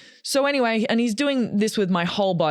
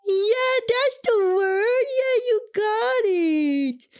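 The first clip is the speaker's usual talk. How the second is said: excited; much slower than usual. Real, not acted. Thanking a listener who found the word, high excited. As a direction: cheer that they guessed it. Someone off-mic supplies the word.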